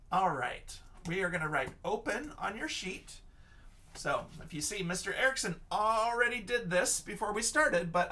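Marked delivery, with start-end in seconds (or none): none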